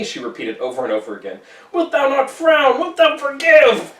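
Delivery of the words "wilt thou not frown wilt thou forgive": high, slow, desperate plea; pleading. Acted. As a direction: beg slowly from high up. Hester begs over and over.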